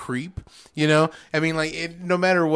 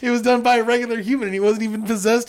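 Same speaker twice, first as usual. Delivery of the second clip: excited retold reaction; 7 semitones higher, 5 dB louder than usual. Retold reaction to shocking kill.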